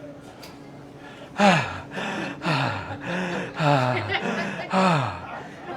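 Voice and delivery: long, soft heaving breaths; out-of-breath panting. Acted. Comic rolling over in bed, out-of-breath panting. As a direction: puff as if exhausted by a tiny effort. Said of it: "get out of breath doing nothing".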